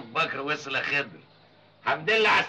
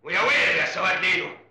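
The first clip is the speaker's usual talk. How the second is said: raised, commanding demand; stern angry; 3 semitones higher than usual. Acted. Father demanding the truth at home, stern and angry. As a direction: insist on the truth, threatening.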